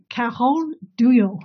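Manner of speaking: drawn-out attempt at French pronunciation; French accent. Shown as a demonstration. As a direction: say the name in your best French accent. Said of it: "put on my best French accent".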